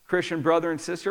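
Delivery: ordinary delivery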